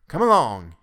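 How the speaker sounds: father sternly leading his son out. A narrator's reading. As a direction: order him along sternly. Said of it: "he said sternly".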